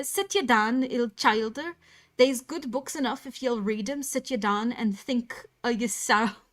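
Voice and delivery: put-on broad rural northern accent, croaked; Joseph's Yorkshire dialect. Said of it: "See, that was more Irish".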